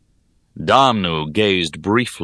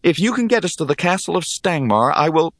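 higher-pitched, earnest declaration; declaring; 9 semitones higher than usual. A narrator's reading. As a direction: announce the wager firmly, pitch raised. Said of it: "I declared".

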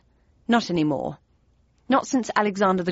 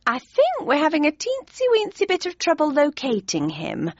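higher, flustered sweet voice; a fluttery, higher mum; flustered mother; 8 semitones higher than usual. A narrator's reading. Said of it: "replied my mother, waving the cake knife".

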